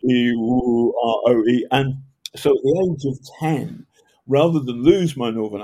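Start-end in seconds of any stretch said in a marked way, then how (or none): 0.1-1.7 drawn-out vowel warm-ups; voice exercises. Shown as a demonstration. Teacher showing projection drills, vowels drawn out. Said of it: "this is stuff like you go".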